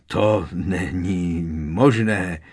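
slow, strained disbelief; forced out with effort. A narrator's reading. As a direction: squeeze each word out of shock. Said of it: "vypravil ze sebe s námahou".

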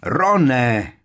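loud, high cry of a name; alarmed shout. A narrator's reading. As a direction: yell to warn a friend. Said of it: "zaborácel Harry".

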